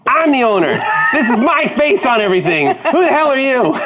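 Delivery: high, indignant confrontation; indignant outburst. Acted. Owner confronting the man claiming his franchise, indignant.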